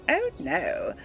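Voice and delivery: ordinary talk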